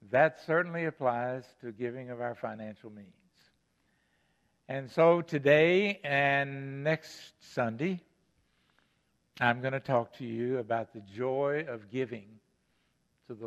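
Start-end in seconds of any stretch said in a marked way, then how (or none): none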